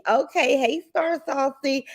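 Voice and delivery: usual speaking voice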